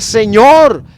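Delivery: loud anguished cry, pitched high. Retold or imitated. Praying aloud to God in the dream, a loud cry. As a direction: call out to God with full force. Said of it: "muy fuerte".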